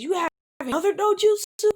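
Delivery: high, loud disbelief; a gasp of disbelief in the words; shocked question. Acted. Tsunade stunned as he reveals another dojutsu, high and loud.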